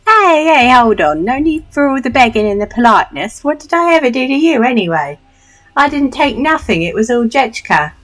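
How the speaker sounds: high, loud protesting Cockney urchin. Acted. Street thief denies stealing to the doctor, loud indignant Cockney. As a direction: protest loudly in hammed-up Cockney. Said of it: "a Cockney voice".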